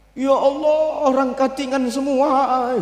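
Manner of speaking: high, drawn-out tearful lament; mock weeping. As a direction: wail it slowly, imitating someone crying. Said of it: "Nangis Rasulullah".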